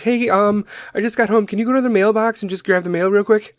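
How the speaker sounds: mock a lazy teen begging; high-pitched entitled kid request; whiny kid. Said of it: "the kids of now would be like".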